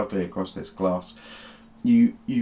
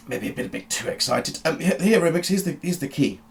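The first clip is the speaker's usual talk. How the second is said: sheepish excited doctor; 8 semitones higher than usual. Acted. GM voices Doctor Beige.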